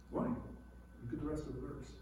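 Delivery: usual speaking voice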